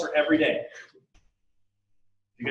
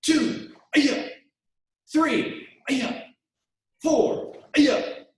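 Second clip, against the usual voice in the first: loud barked count and yells; counting with kiai shouts; 9 semitones higher, 5 dB louder, much slower than usual. Shown as a demonstration. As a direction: shout each count with power. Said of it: "punches on my count".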